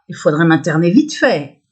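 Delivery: ordinary delivery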